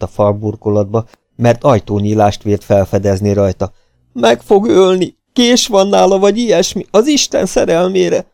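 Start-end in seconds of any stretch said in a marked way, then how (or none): 4.1-8.3 high, loud cries of terror; panicked plea. A narrator's reading. Hunted man warns he will be killed, high panic. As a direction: wail it in fear for life.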